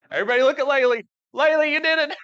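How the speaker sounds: high, loud happy praise; excited cheer. Real, not acted. Cheering on little Laylee.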